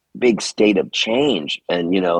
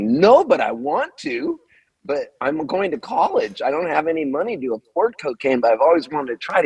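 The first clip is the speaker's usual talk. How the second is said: eager yes; 7 semitones higher than usual. Retold or imitated. Eager teen answering about cocaine.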